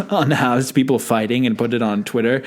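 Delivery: usual speaking voice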